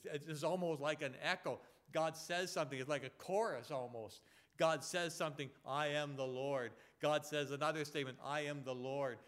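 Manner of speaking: loud, insistent preacher's refrain; forceful preaching. Real, not acted. Preacher stressing God's refrain from Leviticus, forceful preaching. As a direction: drive the repeated phrase home like a chorus.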